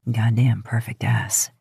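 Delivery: low, rough breathy whisper; low rough whisper, teasing. Acted. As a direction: growl it under your breath, slow. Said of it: "he says roughly".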